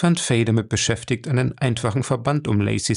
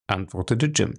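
usual speaking voice

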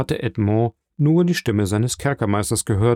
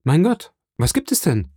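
alarmed question starting high, then dropping; understated startled exclamation; calling out; 6 semitones higher than usual. A narrator's reading. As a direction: ask anxiously what has gone wrong. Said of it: "rief Dantes".

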